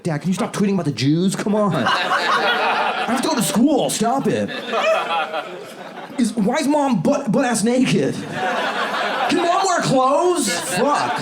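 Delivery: put-on high, whiny kid pleading; embarrassed kid. [name]'s kid pleading with his dad, high whiny voice. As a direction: beg your dad to stop, mortified.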